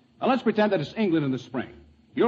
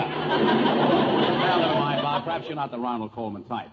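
ordinary talk

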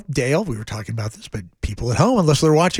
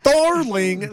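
lightly voiced sultry French darling, higher; mock French; 9 semitones higher than usual. Co-host echoing the French bit, mock sultry. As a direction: coo it like a cabaret singer.

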